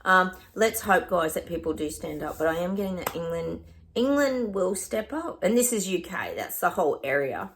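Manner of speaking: usual speaking voice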